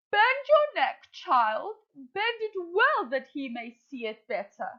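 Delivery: high, eager, pushing mother crow; impatient Madam Crow. Acted. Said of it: "I'm going to put on a voice".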